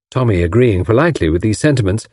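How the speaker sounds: usual speaking voice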